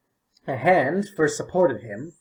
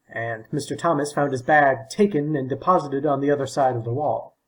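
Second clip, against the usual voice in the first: usual speaking voice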